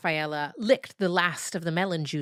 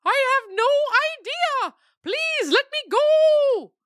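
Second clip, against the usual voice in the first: very high, slow begging whine; frightened dog voice, much higher and louder; pleading captive dog; 7 dB louder than usual. Acted. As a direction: beg to be let go, high and drawn out.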